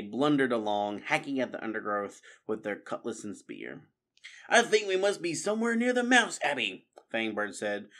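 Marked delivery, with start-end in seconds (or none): 4.5-6.9 high-pitched uneasy underling voice; anxious rat crony. A narrator's reading. Rat lost near the abbey at night, high and uneasy. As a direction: fret aloud, pitched well up.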